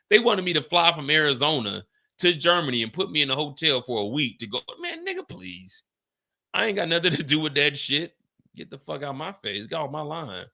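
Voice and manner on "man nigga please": high, soft, drawn-out scoff; dismissive. Real, not acted. Asked to fly to Germany, a high scoffing dismissal. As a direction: wave off the request.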